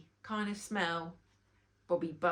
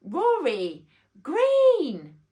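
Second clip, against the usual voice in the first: very loud, slow stretched yell of the name; 7 semitones higher, 13 dB louder, much slower than usual. A narrator's reading. Queen bee yelling the name into a faulty microphone. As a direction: bellow it across the hall, drawn out. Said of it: "yelled again".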